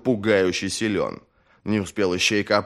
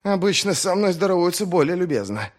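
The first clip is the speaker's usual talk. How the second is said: wheezing; 9 semitones higher than usual. Acted. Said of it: "просипел Ищейка".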